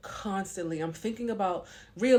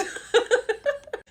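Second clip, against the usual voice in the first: high, loud burst of laughter; real laugh; 8 dB louder than usual. Real, not acted. Vlogger amused at crying over imagined scenarios, high laugh. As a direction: crack up at yourself, high and loud.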